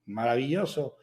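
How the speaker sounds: imitated echo. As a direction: give the word back like a cave. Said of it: "grita algo". (eco imitado, más grave y flojo)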